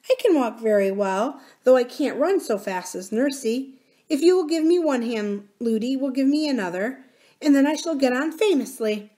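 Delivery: put-on high, eager child's voice; small girl explaining brightly and politely; little princess. Little princess arranging hands to walk, eager.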